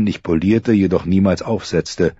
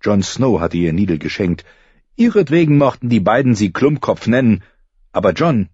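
ordinary talk, nothing marked

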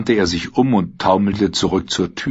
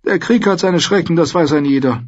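high-voiced lord, holding forth; old noble prisoner, musing; 10 semitones higher, 4 dB louder than usual. A narrator's reading. Lord reflecting on war to his cellmate, musing. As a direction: play a high-pitched lord rambling on.

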